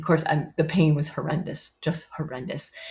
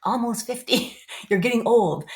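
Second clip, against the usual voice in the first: higher, mocking daughter voice, half laughing; teasing daughter; 6 semitones higher than usual. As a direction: tease mom about her age. Daughters teasing her age.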